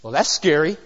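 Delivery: ordinary talk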